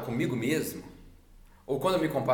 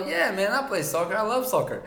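high, loud, eager salesman; put-on enthusiasm; 8 semitones higher, 5 dB louder than usual. Winning over a customer about soccer, put-on enthusiasm. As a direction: oversell it with raised pitch and energy.

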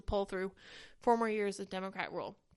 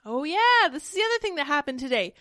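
excited remembering; 4 semitones higher, 8 dB louder than usual. Real, not acted. Suddenly remembering dog news.

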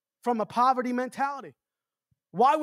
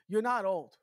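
normal talking voice

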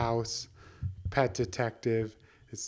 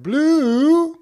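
high, loud sing-song call; 14 semitones higher, 10 dB louder, much slower than usual. Acted. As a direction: call the fish up from the pond. Man calls his fish again.

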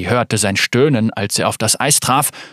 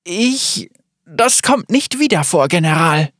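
nervous reply; 5 semitones higher than usual. A narrator's reading. Maya cowed before Darius.

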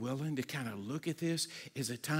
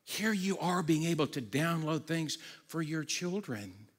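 normal talking voice

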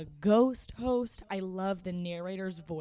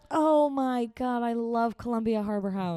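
excited; 4 semitones higher, 6 dB louder than usual. Real, not acted. Excited about favorite restaurant.

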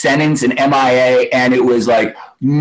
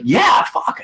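excited exclaim; 12 semitones higher than usual. Retold or imitated. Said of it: "He's like".

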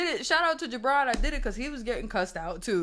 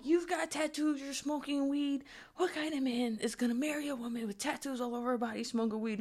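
mock nagging mom; 6 semitones higher, 6 dB quieter than usual. Mother nagging daughter about tattoos and weed, higher. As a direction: nag like a disappointed mom. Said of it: "she said something like".

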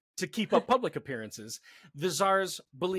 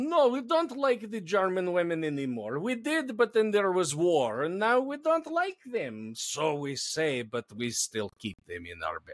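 mock Russian accent; 3 semitones higher than usual. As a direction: play a tsar in an over-the-top Russian accent. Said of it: "wait, let's get into Russian".

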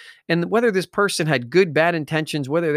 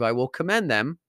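ordinary talk

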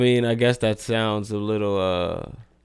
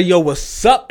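"what's up" pitched high and louder, rising at the end; emphatic greeting; 8 semitones higher, 5 dB louder, slower than usual. Shown as a demonstration. Host shows what changes you good, emphatic "what's up". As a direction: lift "up" into a loud, high question. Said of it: "then that good means".

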